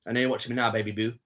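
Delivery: ordinary talk, nothing marked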